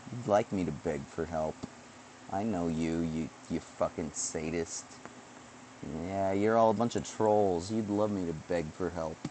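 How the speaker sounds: normal talking voice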